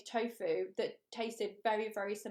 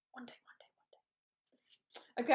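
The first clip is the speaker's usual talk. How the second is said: soft, slow dreamy whisper; hushed and slow, almost to herself; whispered wish; 4 dB quieter, slower than usual. Real, not acted. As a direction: breathe it like a wish.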